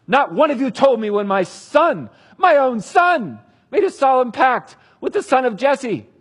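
King Saul accusing his officers, loud and bitter; furious accusation. Acted. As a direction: rail at them as a betrayed king.

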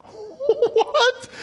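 a high, laughing "What?" in disbelief. Real, not acted. Preacher laughing at Pilate's verdict, incredulous. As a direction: laugh it out, unable to believe it.

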